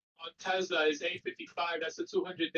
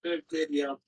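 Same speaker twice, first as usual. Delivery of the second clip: ordinary talk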